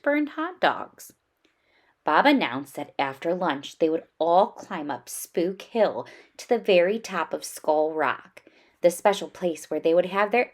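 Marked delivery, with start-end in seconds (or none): none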